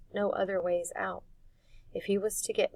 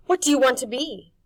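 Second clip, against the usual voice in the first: put-on louder, higher boy pushing; pressing question; 6 semitones higher, 13 dB louder than usual. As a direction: press her hard. Harvey pressing Mar.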